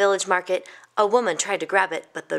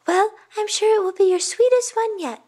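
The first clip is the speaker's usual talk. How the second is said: put-on high, louder, encouraging child; cheerful young boy; 13 semitones higher, 5 dB louder than usual. Youngest boy cheering up his mother, high and eager. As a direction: pipe up hopefully like a small boy.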